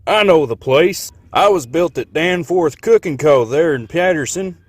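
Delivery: put-on ridiculous Texan accent. A narrator's reading. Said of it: "that ridiculous accent".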